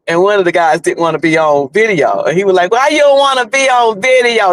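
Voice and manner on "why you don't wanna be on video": higher, drawn-out North Carolina drawl; slow Southern drawl. Retold or imitated. Teasing a friend questioning a shoe shiner, slow Southern drawl. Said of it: "talk with that slow, uh, North Carolina Southern drawl".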